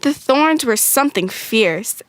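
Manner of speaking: ordinary delivery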